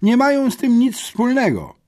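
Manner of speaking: put-on booming yet cockerel-like boy's voice; loud rooster-like voice. A narrator's reading. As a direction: crow it out like a young cockerel. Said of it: "donośny, acz nieco koguci głos".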